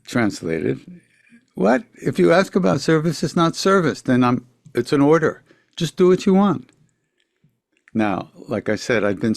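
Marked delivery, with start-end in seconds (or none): 1.6-6.7 guru's retort. Retold or imitated.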